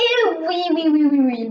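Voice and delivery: bursting out loud after the whisper; sudden loud shout. Shown as a demonstration. Shouting at viewers right after a whisper. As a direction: blast their ears on purpose. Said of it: "je viens de casser vos oreilles".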